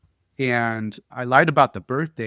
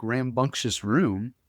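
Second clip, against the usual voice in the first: usual speaking voice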